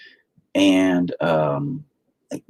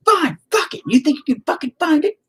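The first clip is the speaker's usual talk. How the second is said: mock furious wife. Husband mimics his pissed-off wife giving up on the search, in a high mock yell. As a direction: fling it back like she's done with you. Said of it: "Man, she was pissed".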